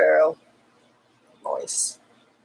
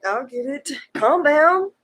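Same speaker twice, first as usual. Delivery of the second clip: higher, rising protest; mock exasperated; 11 semitones higher than usual. Real, not acted. Giving in about fetching the hat, mock exasperated. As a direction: give in with growing fuss.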